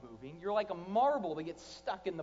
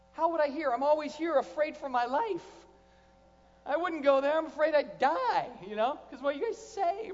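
mock frightened member; 8 semitones higher, 6 dB louder than usual. Mocking fearful church member.

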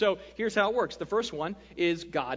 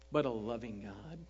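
soft; 10 semitones lower, 7 dB quieter than usual. Acted. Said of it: "his favorite sermon".

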